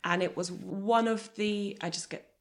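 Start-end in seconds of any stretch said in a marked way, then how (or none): 1.8-2.3 choked up. Real, not acted. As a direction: voice dropping quiet with emotion. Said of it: "I just get emotional thinking about it".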